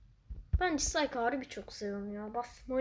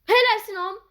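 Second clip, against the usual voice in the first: higher, louder praise; loud cheer; 9 semitones higher, 11 dB louder than usual. Real, not acted. Gamer shouting praise at teammate Hom, loud cheer.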